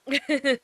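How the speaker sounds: short high burst of laughter; sudden bright laugh. Real, not acted. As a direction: laugh out loud at the comment. Reacting to chat, laughs.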